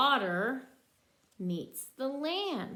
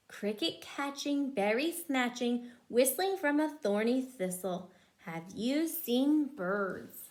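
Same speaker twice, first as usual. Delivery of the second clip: lively rhyming picture-book read; reading to children; 4 dB louder than usual. Acted. Mother reading a bird book to her child, lively rhyme. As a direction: bounce the rhyme for a small child.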